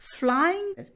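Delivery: FLYING set apart, pitched high; sound demonstration. Shown as a demonstration. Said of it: "I N". (flying को अलग से ऊँचे सुर में बोलें)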